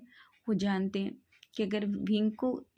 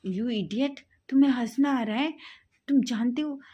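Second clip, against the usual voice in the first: higher, much louder angry outburst; angry friend yelling at laughing friend; furious shout; 4 semitones higher, 6 dB louder than usual. Acted. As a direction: blast him for laughing.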